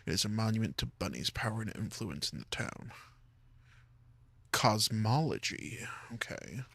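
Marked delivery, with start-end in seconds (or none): none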